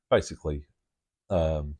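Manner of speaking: ordinary talk, nothing marked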